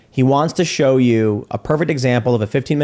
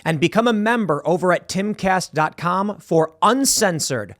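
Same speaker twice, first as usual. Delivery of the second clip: slow, higher-pitched promo read; podcast outro read; 4 semitones higher, slower than usual. Acted. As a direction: deliver it as a measured show sign-off.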